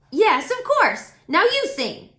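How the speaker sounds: loud, high cheerful crow; crow Woof voice. A narrator's reading. Crow introduces himself to Jazz, loud and cheerful. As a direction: play the chatty friendly crow, loud and bright.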